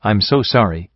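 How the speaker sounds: mildly groveling stallion, pleading; understated begging apology. A narrator's reading. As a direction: plead for mercy with a touch of grovel. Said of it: "the stallion groveled".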